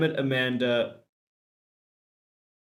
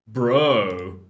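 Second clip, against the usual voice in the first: gym bro; 6 dB louder than usual. Acted. [name] greeting in bro voice.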